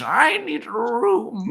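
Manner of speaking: put-on high, slow, cackling old hag; exaggerated crone voice. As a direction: croak it out like a witch. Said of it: "Just my exaggerated, like, crone voice".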